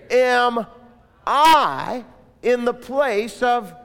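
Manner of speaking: booming sermon delivery; preaching at full force. Acted.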